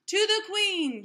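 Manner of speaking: high, loud rallying shout; rally the troops. A narrator's reading. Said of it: "she shouted".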